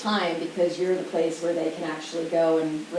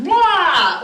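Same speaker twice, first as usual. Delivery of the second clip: high, loud hammed-up blah; mock child meltdown noise; mock meltdown blurt; 10 semitones higher, 7 dB louder than usual. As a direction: blurt it like a kid dissolving.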